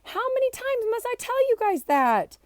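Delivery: fed up with telling them again; exasperated. Acted. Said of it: "said impatiently".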